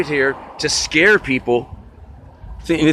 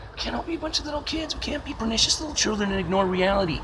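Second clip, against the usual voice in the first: high mock voice; 10 semitones higher, 4 dB quieter than usual. Closing rant in a shifted voice.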